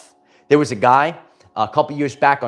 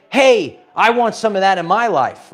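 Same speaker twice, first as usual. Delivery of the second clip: higher, louder enthusiastic call; eager onlooker; 7 semitones higher, 4 dB louder than usual. Acted. People seeing the lighthouse want that too, eager call. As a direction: call out eagerly, as an admirer would.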